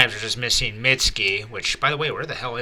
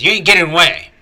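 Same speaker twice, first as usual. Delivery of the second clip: raised, loud challenge; determined threat; 7 semitones higher, 7 dB louder, much faster than usual. Acted. Boruto chases the falling Hidari, determined loud threat. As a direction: call after a fleeing enemy. Acted threat to fleeing enemy.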